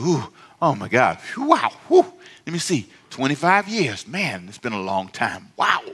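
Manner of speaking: imitating a worn-out pastor puffing out his answer; mock-exhausted, with big whews; winded pastor. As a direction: huff and sigh through it, labouring over the reply. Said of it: "weren't panting and breathing".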